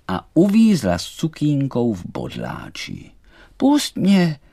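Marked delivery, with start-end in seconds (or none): 3.6-4.4 high, loud cry to be freed; doll's alarmed cry. A narrator's reading. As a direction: shout it sharply in a high voice. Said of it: "vykřikla".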